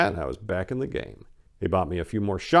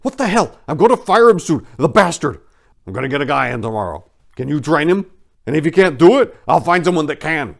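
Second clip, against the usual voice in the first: much higher and louder, furious boss ranting; angry yelling; 10 semitones higher, 11 dB louder than usual. Retold or imitated. Owner yelling at the cook about the manager, furious shouting. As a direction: blow up at him like an enraged owner. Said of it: "he started yelling".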